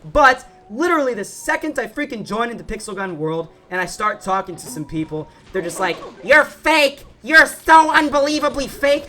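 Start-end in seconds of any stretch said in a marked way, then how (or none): 6.2-9.1 loud, high-pitched accuser; mock accusing shout. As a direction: imitate outraged players hurling an accusation. Retold accusation from other players.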